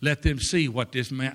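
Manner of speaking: ordinary delivery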